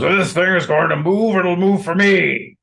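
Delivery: high, loud boast of a warrior king; booming challenge to the crowd; King Lot. Acted. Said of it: "and he goes".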